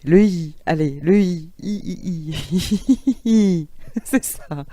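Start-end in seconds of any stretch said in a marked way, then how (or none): 1.6-3.7 laughing on I. Shown as a demonstration. Teacher leading the group in laughter on the vowel I. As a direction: giggle up on the I vowel. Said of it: "Et maintenant le i".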